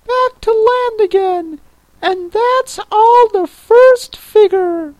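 very high-pitched creature voice; Mock Turtle. A narrator's reading. Mock Turtle explains the lobster quadrille to Alice, very high voice.

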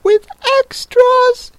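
very high, slow anxious question; a little anxious. A narrator's reading. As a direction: squeak it out worriedly, drawn out. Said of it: "a little anxiously".

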